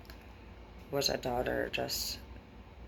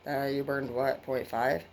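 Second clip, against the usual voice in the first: normal talking voice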